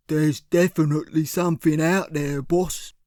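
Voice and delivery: a hint of a dim heavy; henchman Cyril. A narrator's reading. Cyril answers his boss, a lightly voiced dim thug.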